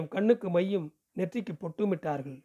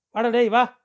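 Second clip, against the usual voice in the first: calling out; 3 semitones higher, 5 dB louder than usual. Acted. Calling the late boy in.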